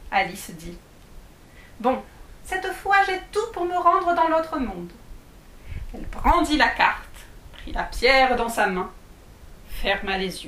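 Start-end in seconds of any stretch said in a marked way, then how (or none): 1.8-4.9 put-on high girlish voice of a six-year-old; little girl voice. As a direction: play Alice, pitched up and eager. Alice speaks.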